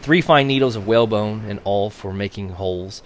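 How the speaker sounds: ordinary delivery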